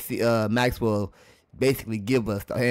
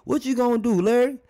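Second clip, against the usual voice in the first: high-pitched taunting challenge; mock mad; 11 semitones higher than usual. YouTuber voices Kareem confronting Larry Bird, mock mad. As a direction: confront a rival, leaning on the L in Larry. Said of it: "when they saying Larry when they mad".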